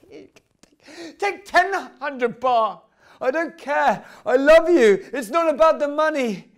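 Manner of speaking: loud, high, overwrought love plea; hammed-up lovesick wail; desperate declaration. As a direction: cry out your love, stretching the opening. Acted declaration of love to Crystal.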